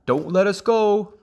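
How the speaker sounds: high pleading call; anxious father frog. A narrator's reading. Father frog to the hawk, high anxious plea.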